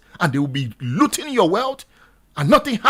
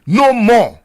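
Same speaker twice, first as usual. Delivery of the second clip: louder shout of NO MORE; protest shout; 6 dB louder than usual. Shown as a demonstration. Radio host leading listeners, loud protest shout. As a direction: bark it out like a protest cry. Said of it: "Begin to scream".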